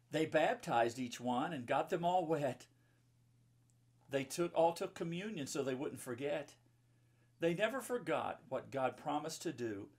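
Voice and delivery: bright, sing-song rhyming read-aloud; lilting storybook verse; reading to children. Acted. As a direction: read the rhyme to a room of kids. Reads rhyming children's book.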